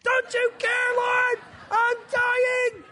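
very high, loud screamed plea; overwhelmed parent wailing for rescue, played for laughs; hammed-up desperation. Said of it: "how I feel like oftentimes when it's me alone".

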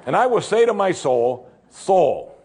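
high, pompous mock address to his own soul; mocking rich man. Preacher mocking the rich man speaking to his soul, pompous. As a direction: lightly ham up the rich man talking to himself.

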